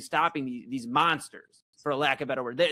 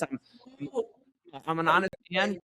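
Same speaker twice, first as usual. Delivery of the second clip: normal talking voice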